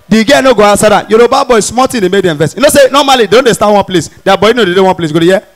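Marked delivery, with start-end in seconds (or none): none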